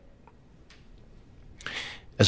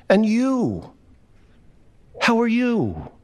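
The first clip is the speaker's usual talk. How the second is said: higher reply; 7 semitones higher than usual. Acted. Narrator returns the greeting.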